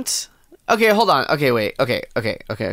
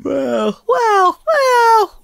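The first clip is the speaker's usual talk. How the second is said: each well climbing higher and louder; hammy building squeal; rising well well well; 4 dB louder, much slower than usual. Pretend. Mock dramatic reaction.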